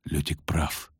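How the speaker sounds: low, soft, sullen knight; gloomy. A narrator's reading. Knight grudgingly backs the poet, gloomy. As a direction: say it glumly, pitch dropped. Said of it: "угрюмо сказал Кагыр".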